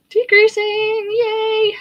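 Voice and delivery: high, loud little cheer of triumph; excited cheer. Real, not acted. Crocheter cheering her finished corner on stream. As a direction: squeal it out like you just nailed it.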